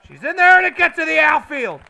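high, loud burst of excitement; excited hit call. Real, not acted. Commentator calls the base hit into the outfield, high loud excited call. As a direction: yell it as the ball drops in.

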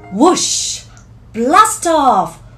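ordinary delivery, nothing marked

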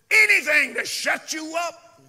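high, loud exasperated outburst; enraged shout. Retold or imitated. Raging kidnapper answers woman's tape request, acted angry shout. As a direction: burst out in acted fury. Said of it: "he just went into a rage".

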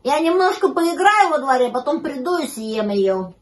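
high, loud, easing down; eager pup announcing plans; puppy asking a favour. A narrator's reading. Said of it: "попросил щенок".

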